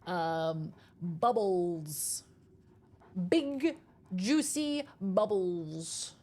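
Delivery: big juicy bubbles drawn out slowly; slow lip-sync practice. Shown as a demonstration. Slowly mouthing words to test the stream's sync. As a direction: mouth each word slowly for the sync check. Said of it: "Here, we can practice".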